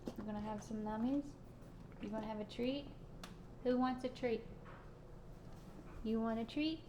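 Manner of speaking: soft sing-song treat voice; talking to a dog. Real, not acted. Soft baby talk to dogs.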